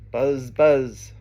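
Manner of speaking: buzzing Z on each word; bee buzz. Acted. Reader reviewing the bee with children, buzzing. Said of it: "He can sound like a bee".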